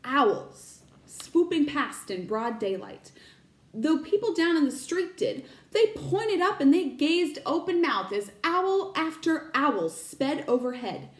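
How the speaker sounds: ordinary delivery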